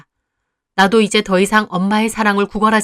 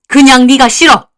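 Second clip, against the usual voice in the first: loud, cutting rejection; angry mother; 4 semitones higher, 10 dB louder than usual. Acted. Mother telling her daughter she dislikes her, loud and cutting. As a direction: tell her flatly you hate her.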